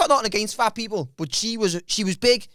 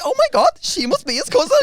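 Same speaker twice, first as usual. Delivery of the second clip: high, louder mock amazement; amazed onlookers; 8 semitones higher, 4 dB louder than usual. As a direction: voice the astonished room, pitched up. Room reacting, acted out.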